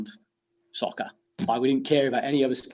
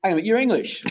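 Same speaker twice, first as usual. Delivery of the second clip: surprised quote; 8 semitones higher, 5 dB louder than usual. Retold or imitated. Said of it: "they're like".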